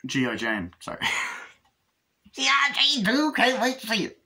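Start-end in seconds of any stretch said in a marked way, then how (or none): 2.3-4.2 very high put-on squeal; mock excitement. Reviewer joking about G I Jane two, mock excited. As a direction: pitch it way up into a silly high voice. Joke quote after bringing up the film.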